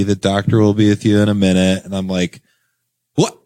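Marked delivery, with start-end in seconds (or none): none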